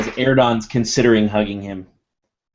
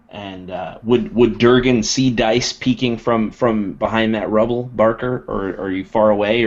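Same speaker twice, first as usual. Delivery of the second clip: ordinary talk, nothing marked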